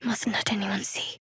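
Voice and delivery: furtive hushed whisper; secretive soft whisper. Acted. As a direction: whisper so nobody notices. Sneaking, hiding something.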